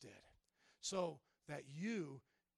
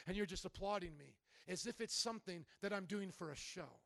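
ordinary talk

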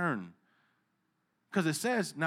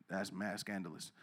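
ordinary delivery

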